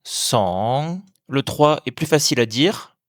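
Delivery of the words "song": "Song" with long open O and final ng, rising. Shown as a demonstration. Teacher modelling Thai two, long "Song" rising like a question. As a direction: start "Song" low, stretch it upward like a question. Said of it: "un ton montant comme une question".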